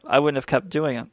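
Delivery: ordinary talk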